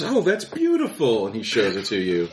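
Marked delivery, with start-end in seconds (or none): 0.0-1.3 much higher, gushing mock praise; fake-sweet praise. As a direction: overdo the proud parent. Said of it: "and he goes".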